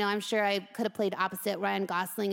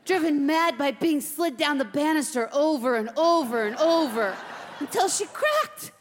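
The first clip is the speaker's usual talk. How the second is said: haunted veteran hamster; 5 semitones higher, 5 dB louder than usual. Acted.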